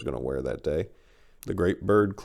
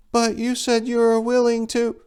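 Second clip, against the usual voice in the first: much higher, pleading female voice; the bird cutting in, high and hurt; bird woman protesting. Acted. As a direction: plead like a wounded giant bird.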